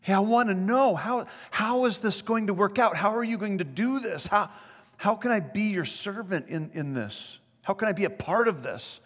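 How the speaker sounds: lightly voiced Mary, high and curious; a hint of a young woman asking eagerly. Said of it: "She's asking in the sense of enlightenment".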